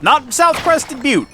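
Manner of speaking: "South" pushed up high and slow as the wrong name; stress on South. Shown as a demonstration. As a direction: slowly lean on "Not South" to correct it. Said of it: "I'm not misspeaking here".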